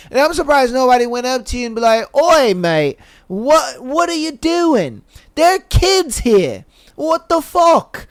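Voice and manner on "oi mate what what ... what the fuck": put-on high, drawn-out British accent sliding into Australian; botched British accent. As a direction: put on an indignant, bad British accent, slowly. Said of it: "My British turned into a bit of, uh, Australian".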